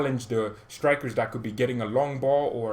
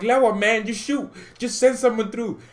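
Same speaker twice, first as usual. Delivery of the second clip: annoyed fan; 11 semitones higher, 5 dB louder than usual. Retold or imitated. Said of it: "I was getting a little bit annoyed".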